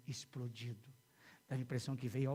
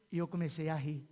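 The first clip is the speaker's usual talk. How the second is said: normal talking voice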